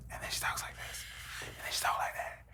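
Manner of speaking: hushed unvoiced whisper; imitating her whisper. Husband mimicking his wife's soft talk, whispering. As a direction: drop to a teasing whisper. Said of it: "She be talking like this".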